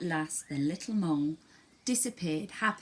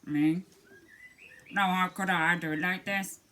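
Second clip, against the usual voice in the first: a lightly voiced cow, louder; a placid cow shrugging it off; 2 semitones higher, 5 dB louder than usual. Cow answering the mole, lightly voiced and louder. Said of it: "he asked the cow".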